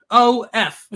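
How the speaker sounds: loud startled mock curse; alarmed cop. Cop at a traffic stop sees the name, a loud startled curse. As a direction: voice the cop realising who's in the car. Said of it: "And the one guy says".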